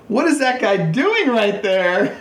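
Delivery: high, slow, puzzled voice; imitating a confused onlooker; baffled quote. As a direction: squint at the weird guy, mock bewildered. Retelling seeing a strange player.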